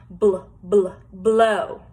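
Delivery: "Bl, bl" pushed out, then "blow"; sound demo. Shown as a demonstration. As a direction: blow out "Bl" firmly, then the full word. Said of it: "this little boy is blowing".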